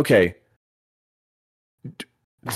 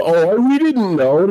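drawn-out, high-pitched feigned ignorance; exaggerated fake-innocent voice; mock innocence; 8 semitones higher, 3 dB louder, slower than usual. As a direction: play dumb, stretching it out in mockery. Mocking the company's feigned innocence.

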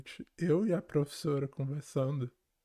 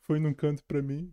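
usual speaking voice

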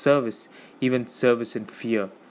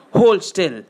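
loud, raised command; sharp order; 7 semitones higher, 7 dB louder than usual. A narrator's reading. Man pinning Gollum down, commanding loudly. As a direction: bark it at a struggling captive.

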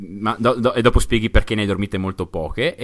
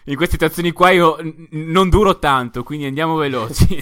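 on a roller coaster; 5 semitones higher than usual. Pretend. Joking ride as excitement climbs.

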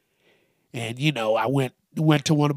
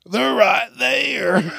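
much higher, louder, slow shout through laughter; laughing shout; 9 semitones higher, 5 dB louder, much slower than usual. Acted. Pointing out the shoes, a slow laughing shout. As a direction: yell it while cracking up.

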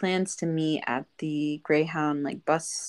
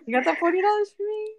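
much higher, fast, laughing demand; playful squeaky shakedown; mock debt collector; much faster than usual. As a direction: tease like a loan shark calling in money. Teasing demand to the scammer.

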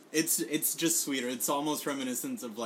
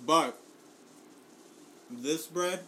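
mouth full; 5 semitones higher, 3 dB louder, slower than usual. Real, not acted. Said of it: "I'm, like, chewing".

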